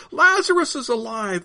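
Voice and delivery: high, excited crowd call; shouting. A narrator's reading. Crowd pointing at Jesus, shouting high. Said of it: "pointing at Jesus and shouting".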